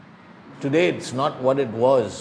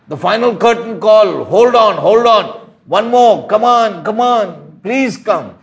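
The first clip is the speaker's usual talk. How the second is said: loud, high impassioned preaching; preaching at full force; 5 semitones higher, 6 dB louder than usual. Acted. Preacher urging the congregation to come, at full force. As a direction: plead with the crowd, voice ringing out.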